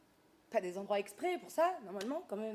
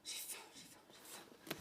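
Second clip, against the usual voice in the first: repeated unvoiced hungry whisper; 17 dB quieter than usual. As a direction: whine it under your breath.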